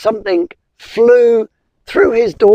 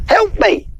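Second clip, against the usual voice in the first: high squeaky plea; pleading spider; 10 semitones higher than usual. Acted. Spider begging the wizard, high and pleading. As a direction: beg in a tiny high voice.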